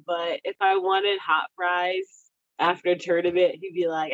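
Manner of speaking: normal talking voice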